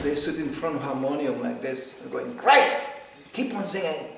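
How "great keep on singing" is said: loud, high cheer, imitating an eager woman; hammed-up, punchy encouragement; fired up. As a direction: urge them on with gusto. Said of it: "she was fired up".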